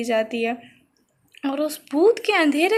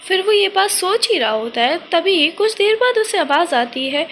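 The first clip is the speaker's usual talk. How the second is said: usual speaking voice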